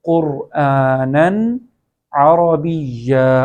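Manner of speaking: slow, drawn-out قرآنن عربیا with a distinct n; clear recitation. Shown as a demonstration. Teacher recites the second example, slow قرآنن عربیا with clean n. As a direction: stretch the vowels, keep the n of قرآنن clear before عربیا. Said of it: "دوسری example میں بھی".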